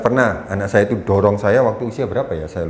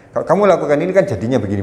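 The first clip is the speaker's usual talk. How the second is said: ordinary delivery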